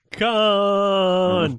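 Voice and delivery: drawn-out, high, loud KHAN, the famous movie yell; Khan yell impression. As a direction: stretch out KHAN like the iconic scream. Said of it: "vocalized by Spock towards the end".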